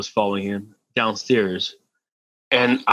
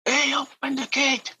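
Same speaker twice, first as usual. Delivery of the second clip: high-pitched mock yell in a Yiddish accent, held back in volume; 14 semitones higher, 7 dB quieter, slower than usual. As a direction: imitate an old Yiddish man yelling from afar, stretched out. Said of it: "crazy yelling".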